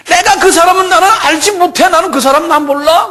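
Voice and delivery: very high, loud protest; vehement denial. Acted. Peter denies knowing Jesus, vehement high shout.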